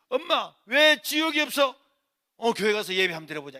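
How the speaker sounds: loud, earnest coaxing; insistent pleading. Retold or imitated. Son urging mother to try worship, loud insistent pleading. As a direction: press her forcefully.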